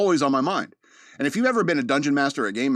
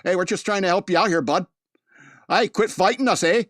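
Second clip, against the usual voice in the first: higher-pitched imitation of a Wisconsin policeman; Wisconsin cop accent; 7 semitones higher, 4 dB louder than usual. Body cam officer to a struggling suspect, Wisconsin accent. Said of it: "body cam videos from La Crosse, Wisconsin".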